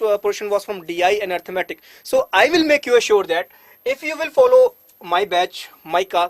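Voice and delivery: ordinary delivery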